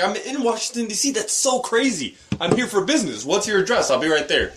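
high-pitched, hammed-up delight at a coincidence; mock excitement. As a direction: play delighted to bait the scammer. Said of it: "No, be like".

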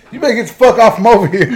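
mock angry warning. Old man chasing the customer off, mock angry and fast. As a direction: hurry him off with a laughing threat.

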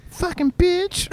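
put-on very high child voice; kid's insult. Retelling his son's curse, in a very high kid voice. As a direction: squeak it like a little boy.

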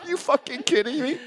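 high incredulous are you kidding me; laughing disbelief at the fine; mock outrage. Seeing ten thousand dollar notice, high disbelief. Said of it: "I was like".

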